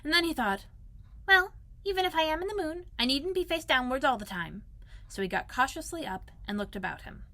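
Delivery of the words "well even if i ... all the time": plucky Piglet. Acted. Piglet reassures himself after falling, in a high voice. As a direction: reason it out in a high little voice.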